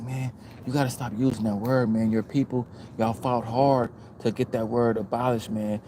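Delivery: low, soft preachy lecture; lightly voiced know-it-all lecturing. Quoting the Indian dude lecturing.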